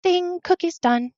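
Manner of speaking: high, drawn-out singsong announcement; high oven-timer ding. Retold or imitated. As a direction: chime it slowly in a high little voice. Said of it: "this high voice say".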